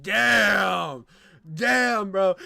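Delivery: loud drawn-out yell; stunned shout. Real, not acted. Reacting to the woman in the clip, stunned shout. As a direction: shout it out in amazement.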